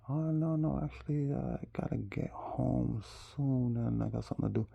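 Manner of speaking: high-voiced excuse, imitating her; mock apologetic woman. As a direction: imitate her politely backing out.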